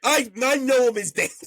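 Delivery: high-pitched indignant pleading; heated protest. Real, not acted. Guesser defends his answer to Don, heated protest. Quarrel over the bet's answer.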